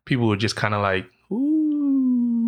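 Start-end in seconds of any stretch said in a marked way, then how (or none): 1.3-2.5 high woozy ooh, lightly mocked; a tipsy crowd going ooh; drunk party ooh. Drunk party people reacting.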